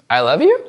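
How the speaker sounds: doubtful upswing on "you" in "I love you"; rising question tune. Shown as a demonstration. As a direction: ask "I love you" with the voice shooting up at the end. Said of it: "I'll go up first".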